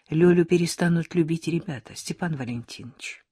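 usual speaking voice

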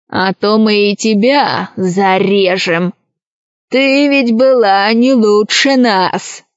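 slow, drawling pirate threat; storybook villain taunting a former accomplice; threatening pirate. Acted. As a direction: draw the words out with menace. Voices the pirate Krys.

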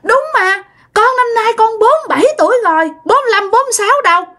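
put-on very high, fast toddler protest; little child insisting on her age in a tantrum; whining tantrum child. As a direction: stamp and whine like a kid who's been contradicted. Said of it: "bắt đầu giãy nảy lên".

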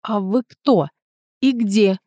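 ordinary delivery, nothing marked

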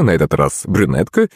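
usual speaking voice

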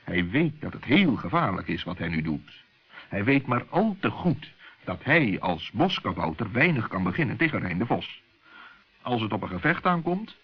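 ordinary delivery

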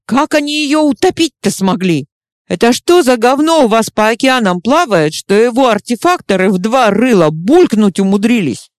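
high, loud outraged rant. Acted. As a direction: demand answers in outrage. Chuma ranting, outraged.

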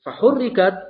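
ordinary delivery

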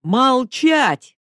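roared. A narrator's reading. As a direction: bark the order to silence her. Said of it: "взревел Кочкин".

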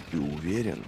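low, hushed question; quiet question. A narrator's reading. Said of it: "негромко спросил Логин у Бояза". (негромко, низким голосом)

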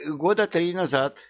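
hasty correction. A narrator's reading. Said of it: "торопливо поправился эмир".

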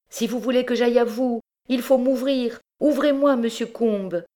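high, loud desperate calls through a door; crying out. Acted. Madeleine shouting through the locked door, high and loud. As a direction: plead at the top of your voice to open up. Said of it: "criait Madeleine".

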